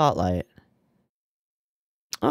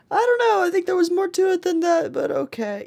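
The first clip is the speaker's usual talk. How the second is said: squeaky mock voice. Mock reaction to game character.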